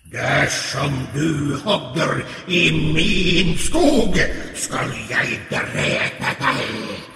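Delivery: slow, drawn-out troll threat; a troll warning the boy off; menacing troll. Acted. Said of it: "kom trollet til ham og sa".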